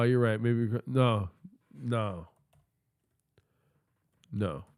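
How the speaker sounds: ordinary delivery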